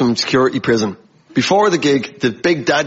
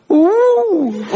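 high, drawn-out crowd ooh; mock crowd ooh. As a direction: ooh like a class of schoolkids. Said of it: "The sound a primary school class makes".

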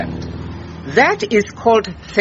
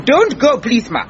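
raised-pitch urgent plea; insistent pleading; 3 semitones higher than usual. Acted. Visitor begging the busy cook to stay, pleading. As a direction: beg them to stay, pitch up on the first words.

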